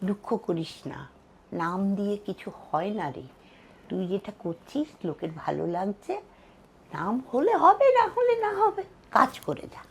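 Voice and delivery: very soft, soothing reassurance; hushed, kindly advice as retold; retold gentle consoling. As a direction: comfort quietly, ending in a near whisper. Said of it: "বলে আমার মাথায় হাত দিয়ে".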